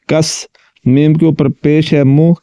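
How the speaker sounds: normal talking voice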